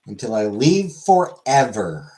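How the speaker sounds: slow, loud, drawn-out proclamation; playfully overdramatic and slow; mock-dramatic. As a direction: announce it grandly, stretching the words. Mock-dramatic departure announcement.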